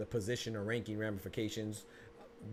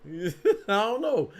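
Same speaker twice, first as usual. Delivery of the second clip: laughing; 10 semitones higher, 10 dB louder, much slower than usual. Real, not acted. Laughing at chat question.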